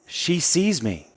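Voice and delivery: put-on high, slow, awestruck little girl; little girl in awe. Little girl to her dad about the waiter, high slow awed child voice. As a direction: breathe it out like a stunned small child.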